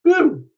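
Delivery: high whooping cheer; woo cheer. Real, not acted. Cheering as the card reading starts, high whoop. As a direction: let out a happy whoop.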